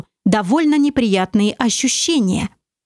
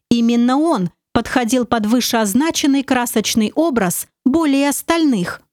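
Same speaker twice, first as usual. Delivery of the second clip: ordinary delivery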